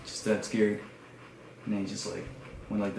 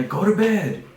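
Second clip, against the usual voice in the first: high, loud mock shout; imitated parent yelling through the door; exasperated parent yell; 9 semitones higher, 8 dB louder than usual. Voices parents shouting back.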